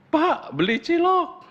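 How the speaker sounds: high, slow parrot squawk calling the vendor. Retold or imitated. Parrot mimics customer.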